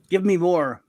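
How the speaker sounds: usual speaking voice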